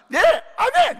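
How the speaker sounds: loud, very high, delighted yes; eager amen. Retold or imitated. Young pastor eagerly accepting the invitation to testify. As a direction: shout your amen with joy.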